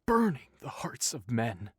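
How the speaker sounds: hushed, dramatic doom-laden voice; a character voice foretelling fire; ominous prophecy. Acted. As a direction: intone it like a seer warning of doom. Prophetic warning read in voice.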